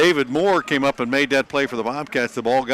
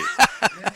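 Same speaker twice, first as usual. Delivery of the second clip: high laugh; 13 semitones higher than usual. Real, not acted. Laughs at own auctioneer joke.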